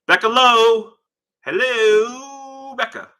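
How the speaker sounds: high, loud sing-out greeting; calling out. Real, not acted. Greeting Becca joining the stream, calling out. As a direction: holler it high and stretch it out.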